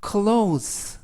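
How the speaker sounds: clothes as one syllable, KLOHZ, no extra vowel; teacher stressing how clothes is pronounced; careful pronunciation. Shown as a demonstration. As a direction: mind the ending of clothes, a plain Z sound. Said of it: "이때 발음에 유의하실 거".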